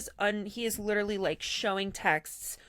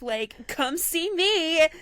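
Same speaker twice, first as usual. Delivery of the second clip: gleeful punchline; 8 semitones higher, 7 dB louder, slower than usual. Real, not acted. Punchline of the story.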